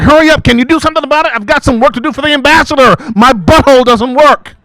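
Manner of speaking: high, loud and fast, in a rush; frantic demand. Retold or imitated. The secretary urging the IT guy to hurry, high, loud and fast. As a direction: hurry him up in a shrill panic.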